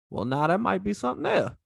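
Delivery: a touch of Cajun accent in the retold line. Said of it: "did you just do a Cajun accent".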